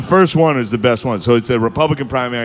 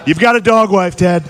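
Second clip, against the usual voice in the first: higher, louder Trump voice; Trump impression; 7 semitones higher, 5 dB louder than usual. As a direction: jab it like Trump. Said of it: "Ted was like".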